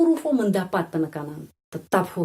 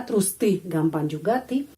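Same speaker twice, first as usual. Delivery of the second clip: ordinary talk, nothing marked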